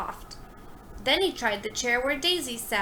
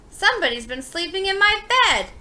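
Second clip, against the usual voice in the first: indignant exclaim; 8 semitones higher, 8 dB louder than usual. A narrator's reading. Huey finds his bed slept in.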